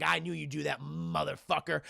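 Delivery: quiet menace. Retold or imitated. Ripley cold menace, quiet.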